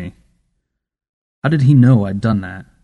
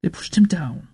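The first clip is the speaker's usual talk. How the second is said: put-on small boy, rising higher into a whisper; animated child; 5 semitones higher than usual. A narrator's reading. Boy to grandmother about bullies, lightly animated child voice. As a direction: let the child get more animated, then whisper the end. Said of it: "The boy's voice became more animated".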